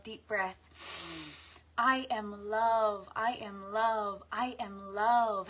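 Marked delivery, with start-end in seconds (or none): 1.8-5.5 slow, loud declaration. Acted. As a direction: say it louder and slowly. Repeated affirmation growing.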